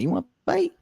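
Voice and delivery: put-on squeaky toddler challenge; high little girl voice. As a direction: pipe it up like a small child. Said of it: "she's like".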